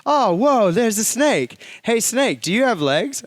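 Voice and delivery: loud, high, startled cartoon voice; hammed-up shock at a snake; mock startled. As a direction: yelp it out as if a snake just appeared. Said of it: "he turns around and he goes".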